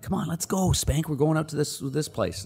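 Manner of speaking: high, eager buddy voice calling him out; mock the guys urging him to the bar; teammates calling out.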